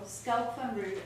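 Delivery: normal talking voice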